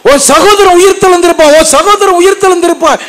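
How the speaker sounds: high, loud and quick; fervent preaching. Acted. Preacher proclaiming Jesus' promise to the sisters, high and loud. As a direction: pour it out from the pulpit.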